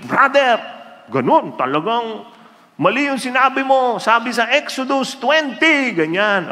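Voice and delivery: high, insistent lecturing voice; a self-righteous online arguer; mock preachy commenter. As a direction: lecture him like a know-it-all. Arguing commenter quoted in debate.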